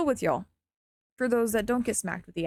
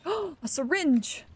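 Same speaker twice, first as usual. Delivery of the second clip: excited gasp; 7 semitones higher, much slower than usual. Real, not acted. Finds syringe in game.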